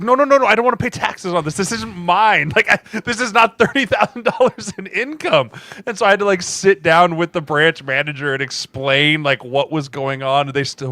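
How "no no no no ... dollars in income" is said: high, loud panicked protest; protesting to the bank. Retold or imitated. Insisting to the bank teller it isn't his income, in a high panicked voice. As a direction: plead with the bank teller, voice jumping up. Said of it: "I was like".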